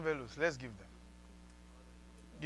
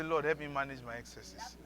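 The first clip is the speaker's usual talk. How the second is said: softer, lower plea; quiet prayer; 3 semitones lower, 8 dB quieter than usual. Shown as a demonstration. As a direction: pray it under your breath.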